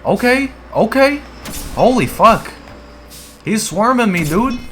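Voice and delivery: high, loud alarmed yelps; panicked as enemies close in; excited. Real, not acted. Swarmed by enemies in game.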